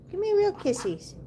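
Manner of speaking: high cooing pet voice. Real, not acted. Asking her duck for kisses.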